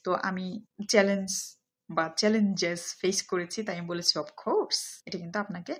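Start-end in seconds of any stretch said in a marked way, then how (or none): 4.2-4.8 "of course" pitched high, lifting on "course"; emphatic intonation. Shown as a demonstration. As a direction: stress "of course" with sure conviction, high and rising. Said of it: "এইভাবে ব্যবহার করতে হবে এবং ইন্টোনেশনটা". ("of course" উঁচু সুরে জোর দিয়ে)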